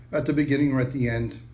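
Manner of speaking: ordinary delivery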